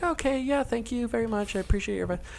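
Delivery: put-on high, soft old-lady voice; eighty-year-old woman. Old woman thanking the salesman on the phone, high soft voice. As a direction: imitate a grateful elderly caller. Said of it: "like, eighty-year-old woman".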